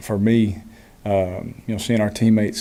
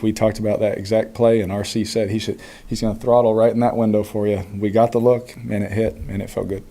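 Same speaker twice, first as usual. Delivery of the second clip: ordinary talk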